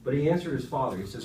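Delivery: normal talking voice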